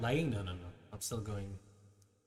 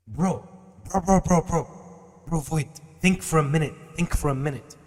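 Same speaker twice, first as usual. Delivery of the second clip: loud, higher exasperated bro; 5 semitones higher, 10 dB louder than usual. Real, not acted. Streamer loudly calling out a chatter to think. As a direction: call him out loudly in disbelief. Scolding chat member in debate.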